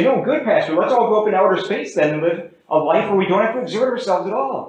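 high-pitched mock eager suggestion; objecting churchgoer. Congregant answers the pastor about avoiding exertion, mock eager. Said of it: "Well, we might say".

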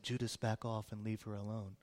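very soft, low, placid murmur; hushed and low, no force at all; mock calm voice. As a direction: play it far too gently, like a serene movie saviour. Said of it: "in a real calm voice".

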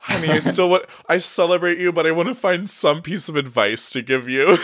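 high-pitched mock mom voice; doting mom. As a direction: coo it like a mother easing into advice. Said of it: "like, a mom thing".

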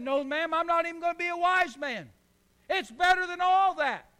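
put-on bursting kid's pride; excited little boy. Boy voiced.

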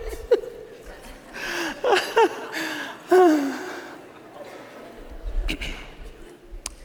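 long wheezing laugh trailing off; helpless laughter; breathless laughter. Real, not acted. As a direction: keep laughing until breath runs out. Keeps laughing.

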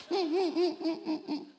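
high, hammed-up blubbering kid; a child crying that the room is too nice; sobbing child. Parent voices crying child.

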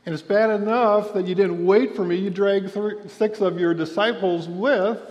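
a hammed-up righteous telling-off; indignant scolding. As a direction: play an exasperated master scolding his follower. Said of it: "We call that indignant righteousness".

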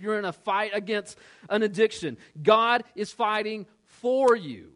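high and loud, building to a fiery close; rising preaching. Acted. Preacher rallying the congregation in their fights, building loud.

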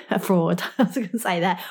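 chuckling through a self-correction; a real laugh at the mix-up; laughing at own slip. Real, not acted.

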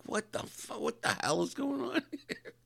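very high, strained disbelief; retold baffled high-pitched reaction; bewildered outburst. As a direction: squeak it out, breaking into a laugh.

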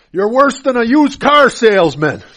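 loud, high mock jab; retold insult. Mourner insulting the pastor after the funeral, loud and cutting. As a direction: fire the insult back at the pastor. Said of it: "I literally had a person immediately after".

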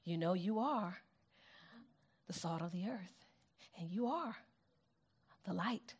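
very soft, low throwaway delivery; casual, unemphatic. Shown as a demonstration. Preacher showing how Jesus did not say it, soft and offhand. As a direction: murmur it with no emphasis at all. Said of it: "he doesn't just look at them and say".

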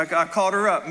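ordinary talk, nothing marked

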